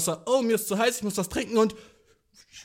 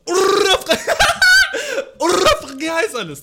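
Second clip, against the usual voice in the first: high, loud laugh breaking through; 9 semitones higher, 10 dB louder than usual. Real, not acted. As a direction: laugh mid-rant. Laughing at his own rant.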